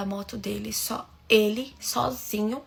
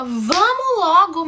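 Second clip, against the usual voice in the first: impatient call; 6 semitones higher, 9 dB louder, much slower than usual. Retold or imitated. Cousin hurrying her.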